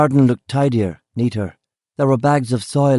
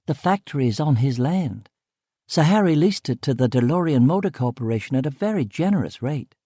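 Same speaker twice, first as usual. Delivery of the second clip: higher, lilting female voice; explaining woman character; 5 semitones higher than usual. Acted. Woman explaining land lease to narrator, high and lilting. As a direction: lift the pitch and lilt through the explanation.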